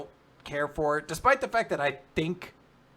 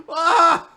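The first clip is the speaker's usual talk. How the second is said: panicked scream; 14 dB louder than usual. Retold or imitated.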